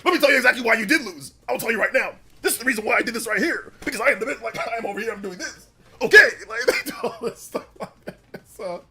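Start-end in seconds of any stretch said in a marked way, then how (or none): 0.0-6.3 fast, loud mock gloating rant; smug villain. Streamer continuing the villain's boastful explanation, fast. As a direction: rush the boast with glee. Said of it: "He's like".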